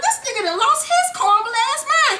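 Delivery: high-pitched incredulous rant; outraged. Retold or imitated. Fan fuming that Ghost manhandled Tasha, outraged.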